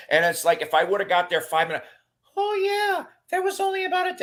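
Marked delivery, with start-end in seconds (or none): 2.4-4.2 very high falsetto imitation; high mimicking voice. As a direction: mimic someone in a squeaky falsetto. Mimics other autograph seekers in falsetto.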